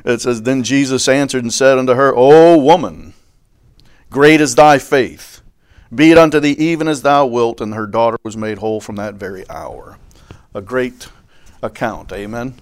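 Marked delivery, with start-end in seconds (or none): 0.4-9.2 scripture reading. Acted. Preacher proclaiming Jesus' words to the woman, loud and ringing. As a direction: lift the blessing up to the rafters. Pastor reads scripture aloud.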